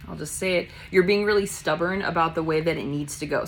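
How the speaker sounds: ordinary delivery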